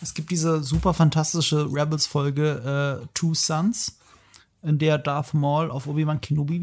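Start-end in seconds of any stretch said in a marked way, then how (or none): none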